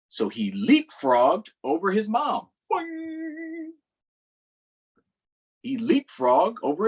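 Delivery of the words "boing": high boing sound. A narrator's reading. Leapfrog sound.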